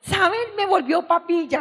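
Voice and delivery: ordinary delivery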